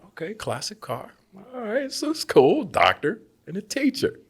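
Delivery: high, upbeat forced cheer; forced optimism. Retold or imitated. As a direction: convince yourself it's fine. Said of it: "So I'm thinking".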